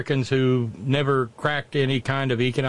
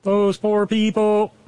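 slow, drawn-out, louder and higher mock sympathy; exaggerated fake pity echoed back at the speaker; sarcastic mock pity; 6 semitones higher, 4 dB louder, much slower than usual. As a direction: stretch it out like you're pitying them, tongue in cheek. Mocking echo of partner's phrase.

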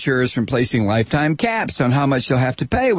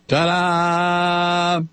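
mock triumphant reveal; sarcastic ta-da; 3 semitones higher than usual. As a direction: announce it like a magic trick.

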